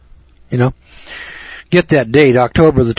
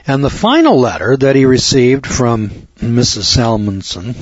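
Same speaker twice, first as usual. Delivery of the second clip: usual speaking voice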